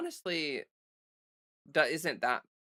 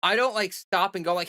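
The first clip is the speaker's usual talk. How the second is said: ordinary talk, nothing marked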